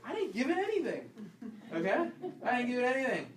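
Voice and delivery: high, laughing protest of innocence; protest, laughing, that you did nothing; defensive spouse. Retold or imitated. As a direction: spouse denying the blame. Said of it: "And, and the".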